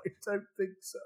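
high little weepy reply; mock tearful. Man retelling his answer to his wife while crying, mock tearful. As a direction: imitate answering through tears. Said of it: "I still cry".

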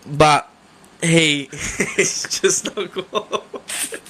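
drawn-out words through laughter; too tickled to finish the point; breaking up laughing. Real, not acted. Co-host cracking up over the fuel fire argument, laughing.

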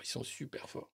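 breathy whisper aside. Real, not acted. Repeats then whispers.

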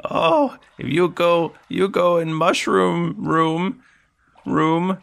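high, halting goblin speech; broken talk in a high creature voice. Acted. Captured goblin warns about the mushroom room, high goblin voice.

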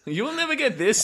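high-pitched, helpless laughter in the words; laughing. Real, not acted. Quizmaster cracking up at a contestant's answer, laughing. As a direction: squeak it out through laughter.

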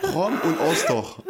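slow, high-pitched mock German accent; drawn-out German accent, amused; best German accent. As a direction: declaim it in exaggerated exam-room German. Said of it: "avec tes meilleurs accents allemands".

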